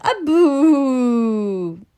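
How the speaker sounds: put-on high, loud, long baby boo; baby's boo. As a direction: pipe up high and stretch out the boo. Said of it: "And the baby looks at him".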